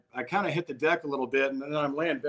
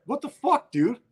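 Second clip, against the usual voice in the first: higher-pitched, mock disbelief; retold incredulous outburst; 9 semitones higher, 4 dB louder than usual. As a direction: imitate a friend's incredulous reaction. Driver's startled reaction retold.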